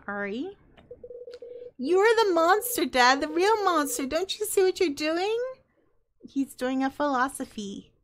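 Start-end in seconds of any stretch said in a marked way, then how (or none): 1.8-5.4 shouting, higher, at her dad; angry accusation. Acted. Teenage daughter shouting at her father in anger. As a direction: yell it like a betrayed teenager.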